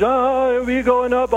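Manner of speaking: high, stretched climbing voice; mock over-stressed rising pitch; voice going up. Shown as a demonstration. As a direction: slide up and stay high, comically. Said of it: "we would always be just going up".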